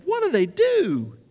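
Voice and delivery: high, loud, drawn-out disbelief; incredulous. Retold or imitated. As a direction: stretch it out in mock disbelief. Acted baffled reply to revival news.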